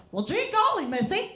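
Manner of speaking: put-on high chipper old-timey showbiz; gee-golly musical star voice; 1930s Broadway star. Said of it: "You talk like a nineteen thirties Broadway musical star".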